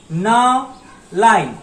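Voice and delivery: ना line said slowly, each syllable drawn out; slow naming demo. Shown as a demonstration. Teacher naming the next kana row to students, slowly. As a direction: draw out ना line clearly. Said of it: "इसको एक साथ में कहते हैं".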